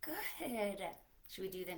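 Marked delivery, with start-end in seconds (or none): 0.0-0.2 barely audible whispered hello; mouse-quiet hello; tiny whisper. Shown as a demonstration. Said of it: "hello as quiet as we can".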